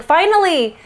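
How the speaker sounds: high-pitched cry of relief, retold; retold exclamation. Viewer talking out loud to the TV, retold exclamation. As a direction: exclaim it as if finally getting your wish. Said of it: "I just said out loud".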